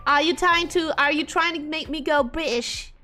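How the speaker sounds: ordinary talk, nothing marked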